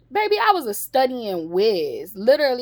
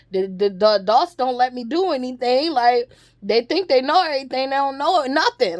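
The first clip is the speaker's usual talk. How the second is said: put-on higher, louder childish frustration; mock child frustration; 6 semitones higher, 4 dB louder than usual. Her four-year-old self ranting at adults, higher and louder. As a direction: rant like an angry little kid.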